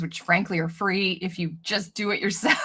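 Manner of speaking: ordinary talk, nothing marked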